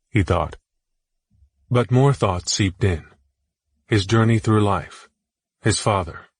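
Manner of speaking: ordinary delivery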